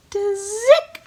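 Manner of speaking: very high tiny "zick"; tiny high bird squeak; hummingbird call. Shown as a demonstration. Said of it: "So we're gonna say that together".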